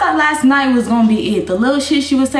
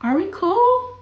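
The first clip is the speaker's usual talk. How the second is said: high, rising question at normal volume; 10 semitones higher, slower than usual. Acted. Streamer asking if the friendship holds, questioning. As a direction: ask it high and lightly. Repeats question, then with swearing.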